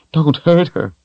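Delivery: high, tearful plea; tearful begging. Acted. Richard begging Darken Rahl in tears, high plea. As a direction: beg through tears. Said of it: "Richard begged in tears".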